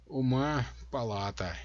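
drawled through the nose, howling mockery; nasal drawl. A narrator's reading. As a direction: stretch the syllables, nasal and whiny. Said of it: "произнесла Самброзия в нос, растягивая слоги и подвывая".

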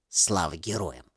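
put-on low, soft old woman's whisper. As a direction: breathe it out like a grandmother. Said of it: "прошептала бабушка".

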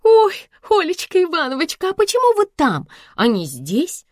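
very high, surprised exclamation; astonished gasp. A narrator's reading. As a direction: gush in surprise at finding the boss outside. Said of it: "ахнула Света".

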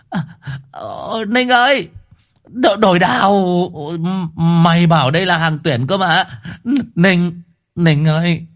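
high, stammering hammed-up pleas; panicked begging. Mạc Nát begs Ninh to swap girls, high and frantic.

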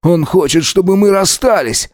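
high-pitched defensive protest; angry accusation. A narrator's reading. Alex blames Max before his lover, angrily defensive. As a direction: accuse the rival at a climbing pitch.

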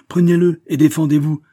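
loud, high furious command; angry challenge. Acted. Banker orders Raoul to take the revolver, furious command. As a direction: order him to fight.

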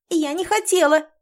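high squeak of protest; rubbery high squeaky toy. A narrator's reading. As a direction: squeak it like a caught mouse. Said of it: "пискнула Лори".

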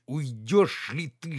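slow, clenched, pressing; through clenched teeth. A narrator's reading. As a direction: push him out through gritted teeth. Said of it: "сжав зубы".

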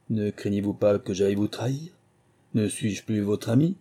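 usual speaking voice

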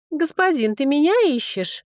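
put-on high-pitched hopeful girl; ringing girlish voice. A narrator's reading. Girl asking the dragon lord if he seeks her, girlish. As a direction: pipe up high, like a young girl. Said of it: "раздался звонкий девичий голос".

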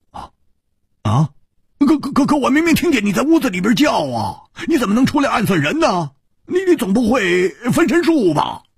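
high, fast, stammering; high shocked stammer; stunned disbelief. Acted. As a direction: blurt it out in shock.

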